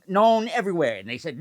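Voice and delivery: normal talking voice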